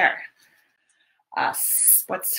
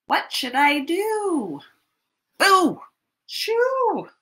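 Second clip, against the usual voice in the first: put-on storyteller shoos the bear for the children; shooing call; 8 semitones higher, slower than usual. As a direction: shout to chase the bear away. Shooing the squirrel.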